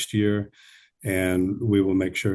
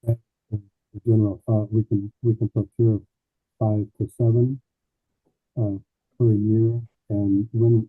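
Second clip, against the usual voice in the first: muffled. Real, not acted. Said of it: "You sound muffled".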